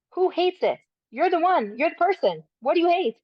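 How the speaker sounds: punchy, high-pitched confrontation; emphatic challenge. Acted. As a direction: challenge the self-critic, pitched high and insistent. Challenging the self-critic rhetorically.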